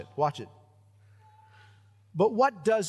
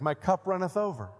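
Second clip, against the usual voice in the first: mock biblical voice. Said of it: "Sounds, sounds so biblical".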